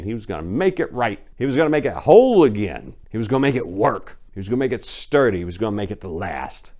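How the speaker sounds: ordinary delivery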